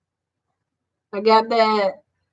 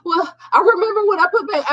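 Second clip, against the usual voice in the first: defensive clerk; 8 semitones higher, faster than usual. Retold or imitated. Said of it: "the girl was like".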